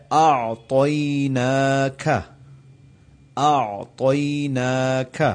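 slow Quranic recitation of اعطیناک with clear ع; tajweed demo; recited slowly. Shown as a demonstration. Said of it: "ایک بات یہاں وضاحت".

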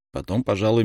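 ordinary delivery

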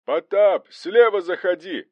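high, loud battle command; urgent high shout. A narrator's reading. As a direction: yell orders across a fight. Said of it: "кричал Захаров".

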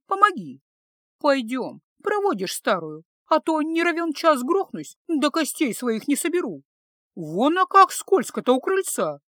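put-on grumbling old woman. A narrator's reading. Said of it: "бубнила старушка, мелко покачивая головой". (ворчливая старушка, причитает)

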